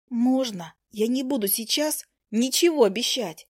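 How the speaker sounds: ordinary delivery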